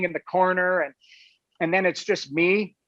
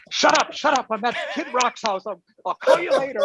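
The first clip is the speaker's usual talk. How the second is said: giggly hushing; 6 semitones higher than usual. Retold or imitated. Hushing girlfriend, laughing.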